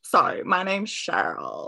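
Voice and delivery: lightly disguised voice, loud; projected put-on introduction; a hint of mimicry, said loudly; Cheryl. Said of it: "mimicking Cheryl's voice".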